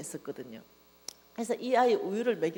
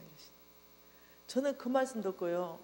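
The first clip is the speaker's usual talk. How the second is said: usual speaking voice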